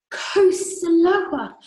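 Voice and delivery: out of breath, very slow, loud and high; panting. Acted. Missus Salt out of breath from the stairs, panting very slowly. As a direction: gasp it out after the stairs. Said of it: "panted Missus Salt".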